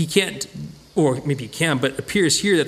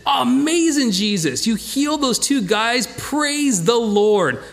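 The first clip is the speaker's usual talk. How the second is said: mock delighted townsfolk; 8 semitones higher, slower than usual. Townsfolk pretending to thank Jesus, mock cheerful and high. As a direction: gush at Jesus with hammed-up joy.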